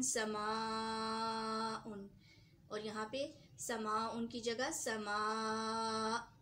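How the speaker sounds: समाउन drawn out, then समा cut short with a catch; teacher recites the example in a Quranic tone; slow recitation demo. Shown as a demonstration. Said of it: "मसलन".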